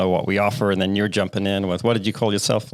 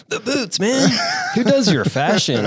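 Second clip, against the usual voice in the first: much higher, hammed-up admiring fan; mock gushing visitor; 13 semitones higher, slower than usual. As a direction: ham up a starstruck booth visitor. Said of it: "come up to our booth and be like".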